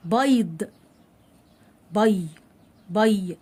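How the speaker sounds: "بيض" with the y glide clearly heard; clear ya sound. Shown as a demonstration. Teacher pointing out the sounded ya, carefully enunciated. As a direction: follow "بيض" with a separated, distinct "بي". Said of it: "نطقت الياء اهو".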